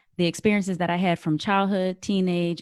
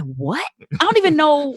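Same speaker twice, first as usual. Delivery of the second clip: baffled disbelief; what, then rising disbelief; incredulous; 6 semitones higher, 4 dB louder than usual. Retold or imitated. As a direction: pitch jumps up, louder. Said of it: "I'm like".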